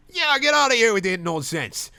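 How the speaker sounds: put-on high, loud jeering drunk; heckler voice. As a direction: jeer it from the crowd. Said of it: "someone else chimes in".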